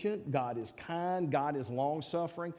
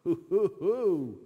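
hooting exclamation; 3 semitones higher, 6 dB louder, slower than usual. Real, not acted. Preacher reacting to his own point.

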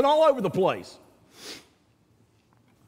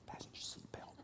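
hushed whisper of a flight attendant; whispered stewardess; 28 dB quieter than usual. Retold or imitated. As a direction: breathe the safety line like a stewardess.

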